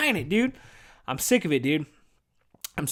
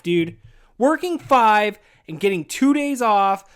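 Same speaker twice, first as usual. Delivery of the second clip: ordinary delivery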